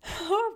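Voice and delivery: high-pitched giggle; short high laugh in character; mocking laugh. A narrator's reading. Water plants laugh at the lily's answer, high mocking giggle. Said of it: "da lachten die Wasserpflanzen".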